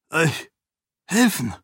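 stockend, mit Pause zwischen „Euch“ und „helfen?“, deutlich höher; „Euch“ … „helfen?“ ungläubig zerdehnt; fassungslos wiederholt. A narrator's reading. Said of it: "wiederholte er". (Ahan wiederholt Sarenes Bitte stockend und fassungslos)

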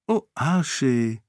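a touch of sugary teasing; sweetly. Acted. Sarene teases her servant, mildly sweet. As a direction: coo his name lightly. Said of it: "sagte sie süßlich".

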